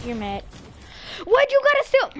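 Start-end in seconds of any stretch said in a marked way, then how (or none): 1.2-2.1 much higher, louder indignant complaint; outraged whine. Real, not acted. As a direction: cry out at the player who robbed you. Complaining at thief in game.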